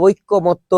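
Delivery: ঐকমত্য with a doubled k, oik-ko-motto; the wrong ঐকমত্য, slowly as oikko-motto; rejected pronunciation. Shown as a demonstration. Teacher rejecting the wrong word, ঐকমত্য slowly with doubled k. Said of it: "কিন্তু শব্দটা ঐকমত্য না".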